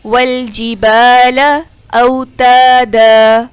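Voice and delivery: slow chanted والجبال اوتادا; measured, drawn-out recitation; tajweed recitation. Shown as a demonstration. Teacher reciting for students to repeat, slow chant.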